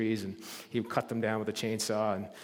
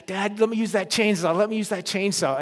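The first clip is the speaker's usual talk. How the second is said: higher, repeated nagging plea; pestering teen; 7 semitones higher, 5 dB louder than usual. Retold or imitated. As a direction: nag dad like a teen who won't quit. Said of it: "every day I was pestering Dad".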